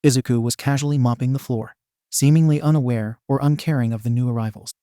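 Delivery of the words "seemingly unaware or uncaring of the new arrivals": usual speaking voice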